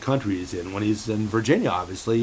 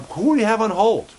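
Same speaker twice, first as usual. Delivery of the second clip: pitched up high and a bit louder; excited high question; 10 semitones higher, 4 dB louder than usual. Acted. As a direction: toss the question up high, eager. Host asks puppet co-host excitedly.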